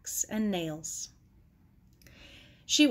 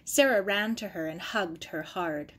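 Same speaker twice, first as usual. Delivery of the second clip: plain reading voice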